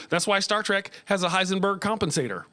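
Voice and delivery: ordinary delivery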